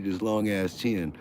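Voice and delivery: ordinary delivery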